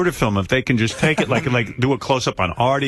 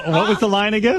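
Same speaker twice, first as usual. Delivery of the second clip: laughing; 6 semitones higher than usual. Real, not acted. Host laughing at the clip.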